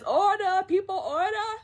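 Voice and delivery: high, loud officious call; calling for order. Retold or imitated. Chifu to soldiers messing around, high loud call for order.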